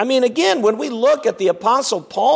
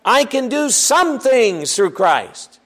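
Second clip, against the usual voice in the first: "some" leaned on, slow and falling; slow, with the weight on "some" things; stress on some; 4 semitones higher, slower than usual. Shown as a demonstration. Preacher contrasting the wrong reading with the verse, some stressed. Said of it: "Notice it isn't just".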